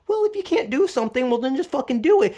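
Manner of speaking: high, fast sneering mimicry of a know-it-all; mock-cheerful, dismissive impression of someone. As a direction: parody the smug advice-giver. Mocking advice-giver's dismissive mentality.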